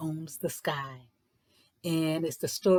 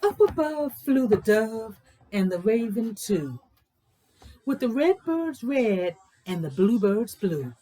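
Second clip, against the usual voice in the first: louder, measured storybook reading; read-aloud story narration; 4 dB louder than usual. A narrator's reading. Teacher reading a picture book aloud to young kids, louder storytelling voice.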